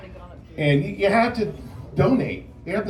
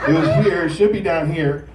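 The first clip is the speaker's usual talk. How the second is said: usual speaking voice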